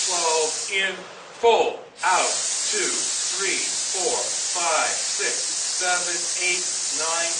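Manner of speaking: loud, steady count-off for the breath; coach counting breaths. Acted. Band director counting students through breathing, loud steady count. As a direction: call out each count to drive the exhale.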